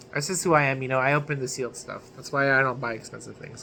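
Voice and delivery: ordinary talk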